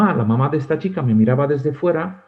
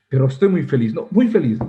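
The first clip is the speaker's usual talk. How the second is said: laughing happily; 5 dB louder than usual. Retold or imitated.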